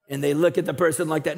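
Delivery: ordinary talk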